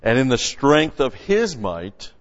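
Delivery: loud, full-force preaching read; preaching scripture. Acted. Preacher reading scripture to his congregation, full force. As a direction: proclaim the verse to the congregation.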